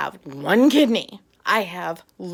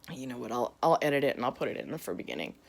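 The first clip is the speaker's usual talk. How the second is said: low, soft, quick aside; talking to yourself under your breath; muttered aside; 10 semitones lower, 8 dB quieter, faster than usual. Real, not acted. Aside to self about editing.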